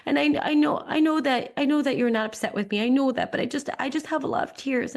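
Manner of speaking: mildly imitated tearful kid; tearful child. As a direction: voice a crying son who can't explain. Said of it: "he was crying".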